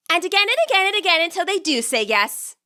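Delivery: "again" repeated, each one pushed up in pitch; rising insistence. A narrator's reading. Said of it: "with complete seriousness".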